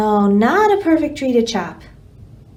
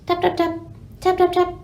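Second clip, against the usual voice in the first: woodpecker tapping; 6 semitones higher, faster than usual. Acted. Woodpecker tapping sound effect.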